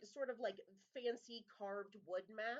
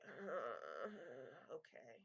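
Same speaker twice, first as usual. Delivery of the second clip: lower, softer, sulky give-in; grudging okay; 6 semitones lower, 5 dB quieter, much slower than usual. Acted. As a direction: concede with a sigh of defeat. Larry gives in grudgingly.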